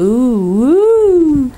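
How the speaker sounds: rising, loud, high ooh of delight; thrilled owl-like hoot; excited whoop. Real, not acted. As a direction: cheer at your own reflection. Admiring own makeup on video.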